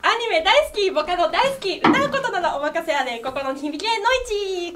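loud, high idol catchphrase; self-introduction idol call. Pretend. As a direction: sell it like a cheerful idol greeting. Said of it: "一番得意なやつ". (元気いっぱいアイドルの決め台詞)

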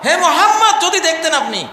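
high, loud, urgent call; Gabriel addressing Muhammad. Acted. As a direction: call out emphatically to the Prophet. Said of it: "জিব্রাইল আসি কয়". (জিব্রাইলের ভূমিকায় উঁচু গলায় ডাক)

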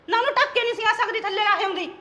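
ordinary delivery

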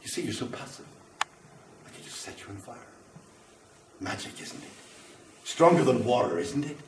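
soft whispered threat to set them alight; menacing whisper. Acted. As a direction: hush it down to a creepy murmur. Menacing quiet threat to audience.

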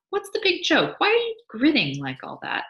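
a wife pressing her husband for the joke; curious wife. Acted. Mabel voiced, higher.

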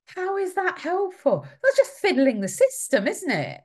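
outraged rhetorical question; incredulous. Real, not acted. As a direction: ask it in open disbelief. Incredulous at school's offer.

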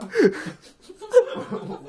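shrieking giggle, way up high; high-pitched burst of laughter. Real, not acted. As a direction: break into a squealing laugh. Reacts to silly username.

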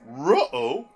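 loud cartoon-dog uh-oh; Scooby-Doo. Friend riffing over a film, doing a cartoon dog noise. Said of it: "second Scooby-Doo noise I've made".

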